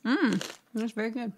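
talking while chewing; mouth full. Real, not acted. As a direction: speak through a mouthful. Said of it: "Chewing over here".

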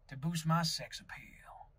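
slow, soft, breathed-out Southern purr; mock-sexy hushed drawl; sultry. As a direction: drop to a slow, seductive near-whisper. Boasting of accent's sex appeal.

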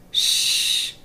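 a hushed shh; shushing whisper. Acted. Miss Hippo hushing the detectives over sleeping babies, whispered. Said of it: "said Miss Hippo".

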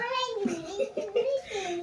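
soft, high-pitched giggling; giddy laugh. Real, not acted. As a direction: giggle high and quietly with delight. Giddy while unpacking gifts.

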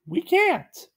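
very high cartoon clay boy; high puppet voice; Gumby's high voice. A narrator's reading. Gumby refuses Pokey, high cartoon voice. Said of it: "Gumby said".

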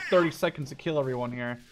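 ordinary delivery

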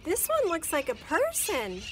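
put-on boy voice. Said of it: "[name] describes the robot".

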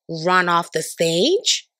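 plain reading voice

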